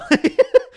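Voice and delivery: loud high laugh at his own joke; real laugh. Real, not acted. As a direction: burst out laughing.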